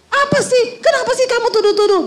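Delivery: high, fast defensive snap; angry husband. Retold or imitated. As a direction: lash back at the wife's question, high and quick. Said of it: "Marah, saudara". (suami marah membentak istri dengan suara tinggi dan cepat)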